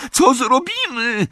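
very high, drawn-out shriek; panicked scream. A narrator's reading. Hermiona over the roar of fire, panicked scream. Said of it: "wrzasnęła Hermiona".

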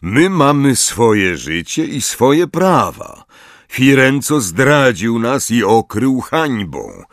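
slow, high, solemn centaur voice; a centaur leader refusing Hagrid; stern centaur. Acted. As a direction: pronounce judgment like a proud chief. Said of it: "odrzekł Magorian".

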